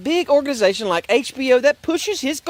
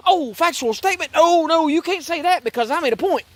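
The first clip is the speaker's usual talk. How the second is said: higher, whiny mock voice; mocking whiny Bill Maher; 6 semitones higher than usual. Mocking the host to his viewers, whiny higher mock voice. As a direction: sneer it like a pouting host. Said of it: "In other words".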